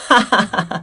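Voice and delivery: high giggle again; imitated laugh. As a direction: giggle like him. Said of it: "that's his laugh".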